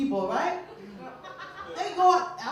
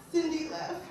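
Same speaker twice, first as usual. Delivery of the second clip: usual speaking voice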